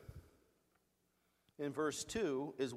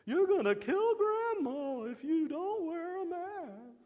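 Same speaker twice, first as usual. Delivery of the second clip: much higher and louder, slow, shrill-free mock outrage; mock hysterical accusation; 13 semitones higher, 6 dB louder than usual. Preacher mocking last year's mask-panic accusers, high mock outrage. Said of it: "wasn't there emotional hype in this last year".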